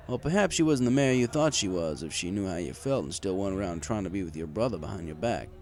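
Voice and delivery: ordinary delivery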